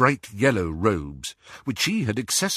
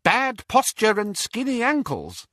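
high mimicked fault-finding; critical great-aunt; 13 semitones higher than usual. A narrator's reading. Young witch quoting great-aunt's insult, high mock criticism. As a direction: mimic her criticism, pitched right up. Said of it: "And then".

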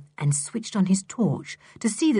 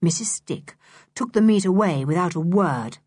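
usual speaking voice

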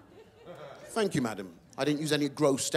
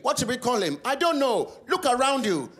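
parents voice; 7 semitones higher, 3 dB louder than usual. Retold or imitated. Parents voiced naming baby.

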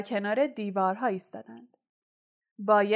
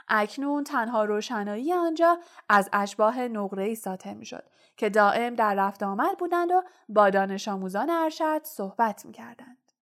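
ordinary delivery, nothing marked

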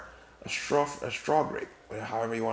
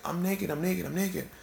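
mild mock scream; 6 semitones higher than usual. Adam reacts after biting the apple, mock panic. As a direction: imitate a panicked cry without really screaming. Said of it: "he started screaming".

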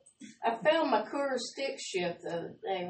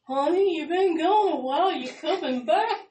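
higher, louder mock-sweet honey voice; clueless ex-husband; 7 semitones higher, 6 dB louder than usual. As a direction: play the oblivious ex, sugary and cluelessly hopeful. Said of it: "her ex calls and goes".

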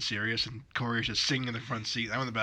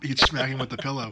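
ordinary talk, nothing marked

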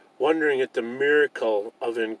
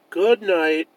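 ordinary delivery

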